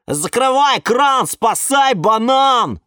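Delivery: high, loud, slow wail. A narrator's reading. Banana plant wailing in the morning panic, slow loud wail. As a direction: lament loudly and slowly for the banana. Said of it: "заголосил банан Степан".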